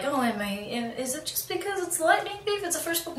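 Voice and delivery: high, soft wondering voice; voiced self-doubt. Reader wonders if it was just the first book, self-doubting. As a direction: question yourself, small and high.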